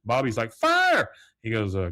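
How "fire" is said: put-on high, loud kid's scream; mock alarmed yell. Bobby steps out and sees fire, high alarmed yell. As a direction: shriek the alarm like a boy. Retold boy yelling fire.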